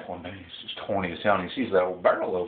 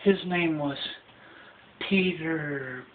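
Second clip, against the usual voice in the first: higher, slow dreamy gushing; swooning Cinderella; 7 semitones higher than usual. Retold or imitated. Cinderella gushing to the godmother about a man. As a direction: gush about him dreamily.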